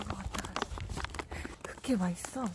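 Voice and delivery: soft whisper to the dog. Real, not acted. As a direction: whisper gently while it eats.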